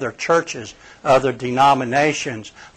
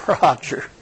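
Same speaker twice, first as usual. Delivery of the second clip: tearful Jesus; 4 dB quieter than usual. Retold or imitated. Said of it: "with tears running down his face, he says".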